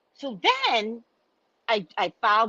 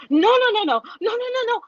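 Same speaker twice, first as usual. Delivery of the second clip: high, hurried, frantic no's; mock staff begging her to stop; panicked office staff; 11 semitones higher than usual. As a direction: rush in to calm her down.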